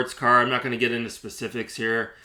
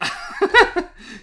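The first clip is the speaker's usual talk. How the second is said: squealing high laugh; 4 dB louder than usual. Real, not acted. Laughing at the seller's excuse, high squeal. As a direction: burst out laughing.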